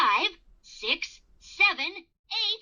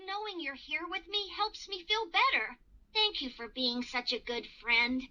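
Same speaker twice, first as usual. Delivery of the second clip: soft, grateful cartoon owl; quietly relieved and tender; comforted; 4 semitones lower, 8 dB quieter than usual. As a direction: thank a friend softly.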